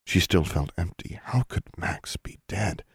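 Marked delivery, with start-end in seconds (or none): 1.2-2.8 whispering. Acted. Stunned inner thought.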